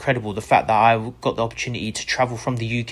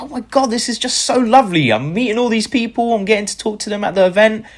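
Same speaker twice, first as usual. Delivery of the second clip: gushing delight; 11 semitones higher, 5 dB louder than usual. Retold or imitated. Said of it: "And I was like".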